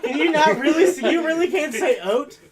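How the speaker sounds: high, loud, laughing disbelief. Real, not acted. Mocking friend's pronunciation.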